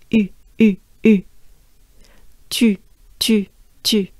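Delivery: short French "U", then "Tu", each separate; droopy-face French U. Shown as a demonstration. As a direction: model "U" and "Tu" in isolated bursts. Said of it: "droopy face that should help you pronounce".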